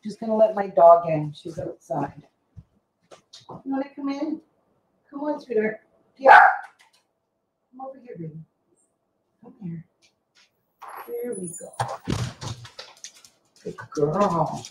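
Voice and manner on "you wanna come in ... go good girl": high, soft pet talk; coaxing the dog. Real, not acted. Vlogger coaxing her dog to come inside, softly.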